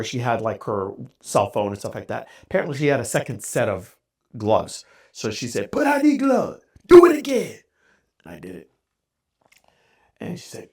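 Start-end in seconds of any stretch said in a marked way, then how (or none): 5.7-7.6 put-on high, loud order in a Spanish accent; Spanish-accented drill order, pitched up; thick Spanish accent. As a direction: imitate a bossy Spanish-accented command. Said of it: "in her thick Spanish accent".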